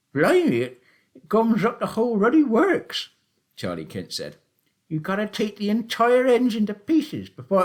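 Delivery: put-on high, loud old countryman's voice; sly old taxi driver. A narrator's reading. Old Charlie teasing Willum about the shoot, high and loud.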